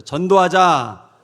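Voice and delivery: loud, drawn-out call to repeat; rallying shout. Real, not acted. Preacher has the congregation repeat after him, rallying shout.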